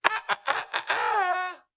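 high, shouted rooster crow cries; mock rooster squawk. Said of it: "The rooster said".

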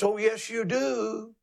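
a lightly voiced, slow rooster crow. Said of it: "It's not cock-a-doodle-doo. It's".

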